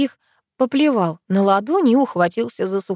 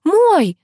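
high, loud frightened shriek; startled high yelp; piercing scream; 11 semitones higher, 6 dB louder than usual. A narrator's reading. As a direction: cry out suddenly in panic. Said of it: "раздался пронзительный, душераздирающий крик".

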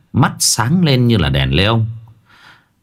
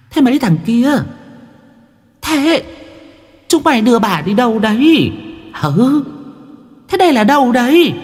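put-on much higher, brash old ghost granny; shrill old ghost woman. A narrator's reading. The ghost from the jar demands where she is, high and brash. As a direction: squawk like a bewildered old spirit hag. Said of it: "Đoạn nó bảo".

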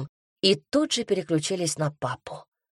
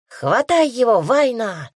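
put-on higher, louder girl's cry of glee; excited shout; 5 semitones higher, 5 dB louder than usual. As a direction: yell at her sister to grab the cat. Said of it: "кричала та". (девочка кричит сестре, азартно и громко)

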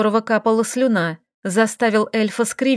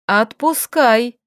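slow, stern order; stern command; much slower than usual. A narrator's reading. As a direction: order it slowly and firmly. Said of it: "грозно произнесла она".

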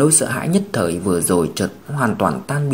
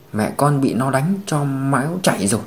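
ordinary delivery, nothing marked

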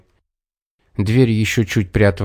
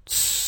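short, soft "tsss"; irritated hissed shush; 12 dB quieter than usual. A narrator's reading. Irritated fox cuts off the bear, hissing. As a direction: hiss him quiet, annoyed. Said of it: "зашипела раздражённая лисица".

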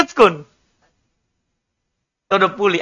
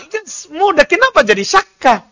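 ordinary talk, nothing marked

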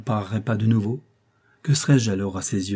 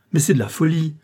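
high-pitched incredulous outcry; exclaiming in protest; 7 semitones higher, 4 dB louder than usual. A narrator's reading. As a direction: cry out at such madness, pitch jumping up. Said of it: "s'écria monsieur de Broglie". (exclamation indignée, voix haut perchée)

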